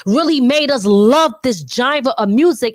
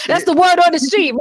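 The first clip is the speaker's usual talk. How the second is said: quick, higher comedic Martin Lawrence bit; Martin Lawrence impression; 5 semitones higher, faster than usual. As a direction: toss it off fast like Martin Lawrence doing a bit. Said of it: "like Martin Lawrence".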